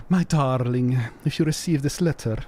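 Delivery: put-on lightly performed reading of an old letter; theatrical reading of a love letter; dramatic letter reading. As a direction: read it out like a heartfelt note. Reads a found letter aloud.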